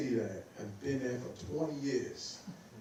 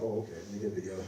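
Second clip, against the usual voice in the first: fast, lower, hurried self-talk; flustered rush; 4 semitones lower, much faster than usual. Retold or imitated. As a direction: rush it like you're scrambling. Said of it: "I was like".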